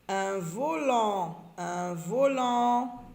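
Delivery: volant said slowly, both syllables stretched; drawn-out "an". Shown as a demonstration. Teacher letting readers hear the sound in volant, drawn out. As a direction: stretch "volant" so the final lant rings. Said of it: "J'entends en dans volant".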